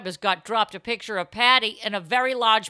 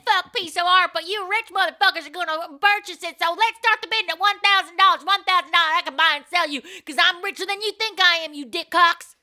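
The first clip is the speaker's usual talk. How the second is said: auctioneer=33; 7 semitones higher, 4 dB louder than usual. Pretend. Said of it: "she'd actually be a good auctioneer".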